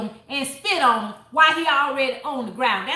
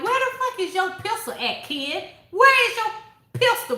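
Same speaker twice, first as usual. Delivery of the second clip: outraged at the character; 8 semitones higher than usual. Retold or imitated. Shouting at character in book.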